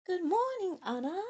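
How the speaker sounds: high lilting sing-song greeting. A narrator's reading. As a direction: sing it up high, sugary sweet. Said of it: "in her usual sing-song voice".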